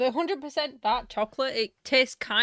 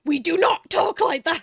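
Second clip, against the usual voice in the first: indignant shout; 6 semitones higher, 7 dB louder than usual. Retold or imitated. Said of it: "a woman came up".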